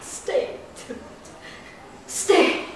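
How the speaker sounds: whispered plea; hushed, fearful command; Juliet. Acted. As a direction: whisper it to a ghost.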